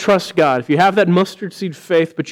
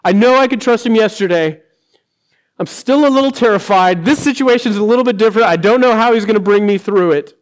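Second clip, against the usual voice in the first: higher, louder worried inner voice; anxious believer; 5 semitones higher, 5 dB louder than usual. Pretend. Believer admitting fear about a new trial, higher and louder. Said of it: "A process of knowing".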